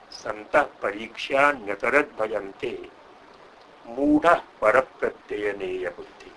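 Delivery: loud, measured recital of classical verse; Sanskrit verse recitation. Acted. Lecturer quoting Kalidasa's line to the audience, verse recitation. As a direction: declaim each line strongly, pausing between lines.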